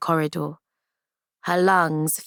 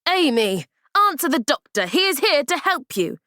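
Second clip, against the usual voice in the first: higher, sharp order; sharp command; 9 semitones higher than usual. Acted. Nurse orders Amy to answer the doctor, sharp. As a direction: snap her into answering. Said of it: "The nurse's voice frightened Amy".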